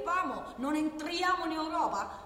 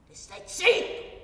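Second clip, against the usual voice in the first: higher, louder, slow "te stai zitto!"; shouted refrain; 4 semitones higher, 6 dB louder, slower than usual. Acted. Worker ranting about silenced workers, shouted slowly. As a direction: hammer the refrain home. Rhetorical rant.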